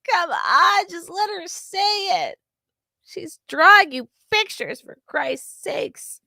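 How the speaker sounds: high, loud pleading, slowing at the end; pleading viewer. Retold or imitated. A viewer begging the pilot to let her say it, high pleading. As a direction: beg him to give in, voice up high. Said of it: "and you're like".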